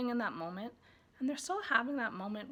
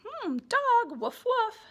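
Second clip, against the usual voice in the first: much higher, louder woof, woof; playful dog noise; 12 semitones higher, 10 dB louder, much slower than usual. Shown as a demonstration. Parent reading about the dog, in a high playful woof. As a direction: bark playfully for the child. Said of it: "later you're saying".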